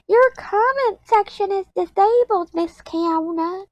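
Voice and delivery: very high, squeaky mimicking voice; high-pitched mocking put-on voice, slowing on the name; mock commenter. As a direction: imitate a whiny complainer in a tiny high voice. Mimics a commenter's complaint to her.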